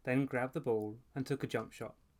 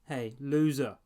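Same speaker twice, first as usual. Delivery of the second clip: much louder jeering call; angry shout; 3 semitones higher, 9 dB louder, slower than usual. A narrator's reading. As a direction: yell it down from an upstairs window. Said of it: "an angry voice interrupted shouting".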